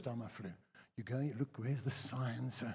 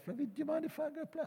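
higher, quick, softer mock-sweet ask; sweetly asking wife; 13 semitones higher, 4 dB quieter, faster than usual. Husband imitates asking his late wife to play golf, mock-sweet. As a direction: ask your wife's permission, sweetly. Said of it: "I don't have to say to my wife".

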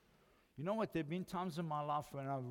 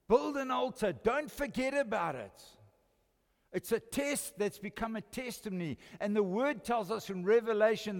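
preaching at full force; 7 semitones higher, 8 dB louder than usual. Acted.

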